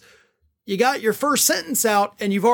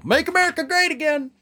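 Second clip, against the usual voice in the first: a higher mock Trump slogan, dragged out on great; mock Trump sign-off; 8 semitones higher than usual. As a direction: ham up his closing catchphrase. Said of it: "I love how he always sounds off with that".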